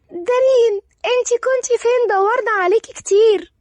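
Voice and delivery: put-on high-pitched little kid voice; excited child. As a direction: call out like a child who has missed someone. Street children greet Darin in story.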